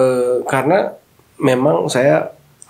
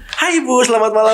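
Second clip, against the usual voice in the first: bright, much higher hotel-desk welcome; sudden cheerful greeting; 9 semitones higher than usual. Retold or imitated. Coworker turns from crying to greet the guest, high and cheery. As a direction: switch from tears to a sunny hello.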